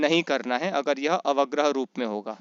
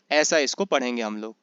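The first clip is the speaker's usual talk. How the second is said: ordinary delivery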